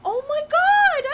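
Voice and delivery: shouted, slow oh my god; loud surprised gasp. Real, not acted. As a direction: blurt it out in shock. Suddenly gets the joke.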